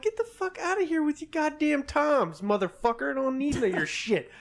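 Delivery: high-pitched, exasperated rant; mock angry telling-off. Podcaster imagining telling off Dallas show-offs, mock angry. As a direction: tell them off in a hammed-up rant.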